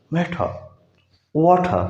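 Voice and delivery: मैठा and वाठा with a hard aspirated ठ, no r; British t sound. Shown as a demonstration. Teacher showing British matter and water. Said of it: "ठे की आवाज़ डालेगा".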